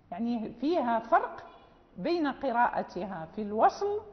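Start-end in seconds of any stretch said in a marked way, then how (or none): none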